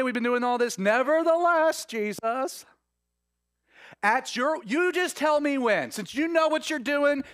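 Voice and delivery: high, loud, mocking grumble; sarcastic Peter. Peter mocking Jesus about the net, loud and sarcastic. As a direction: roll your eyes at the carpenter giving fishing tips. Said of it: "I sense sarcasm".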